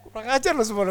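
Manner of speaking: high, loud comic outrage; mock scolding. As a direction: berate the deserting helpers for laughs. Retells scolding helpers who deserted him.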